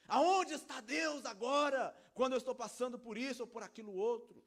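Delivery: loud, high challenge to God; acted outraged questioning; indignant. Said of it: "essa indignação é fruto".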